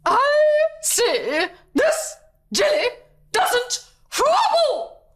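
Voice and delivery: very high, slow, hysterical screech. A narrator's reading. Princess shrieks at the cook over the jelly, hysterical. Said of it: "screeched the princess hysterically".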